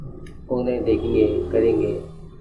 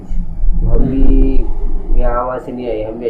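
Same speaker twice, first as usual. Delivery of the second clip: slow, much louder, drawn-out indignation; indignant outburst; 11 dB louder, slower than usual. Real, not acted. Teacher insisting to the host he deserves respect, indignant and loud. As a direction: hammer it home with wounded pride. Teacher asserting his family pride.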